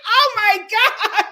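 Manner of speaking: high, loud, drawn-out swooning reaction; retold gushing. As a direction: imitate yourself gushing over an accent, high and loud. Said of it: "We were like".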